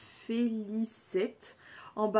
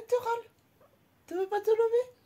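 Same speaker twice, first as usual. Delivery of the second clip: high sing-song pet talk; 12 semitones higher, 4 dB louder, faster than usual. Real, not acted. Owner cooing baby talk to her lazy pet.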